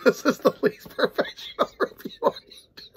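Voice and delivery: high, giggling talk; laughing through it. Real, not acted. Reviewer admitting it's unprofessional, high giggling. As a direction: say it while cracking up. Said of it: "I can't keep a straight face".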